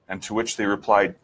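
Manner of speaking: usual speaking voice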